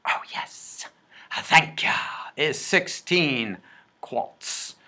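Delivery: hushed whisper, slow on sixteen; whispered aside. Pretend. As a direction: whisper the answer as if sharing a secret. Whispered aside while computing.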